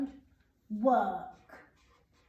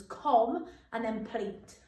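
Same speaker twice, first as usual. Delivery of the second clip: "com" and "pleat" said apart, slow and separate; syllables split; slower than usual. Shown as a demonstration. Teacher splitting "complete" into syllables for pupils. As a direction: break "com" from "pleat" clearly. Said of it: "split it into its syllables".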